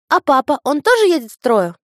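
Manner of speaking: высоко, громко, по-детски; любопытная девочка; детский голос. A narrator's reading. Дочь спрашивает о папе. (детский голос)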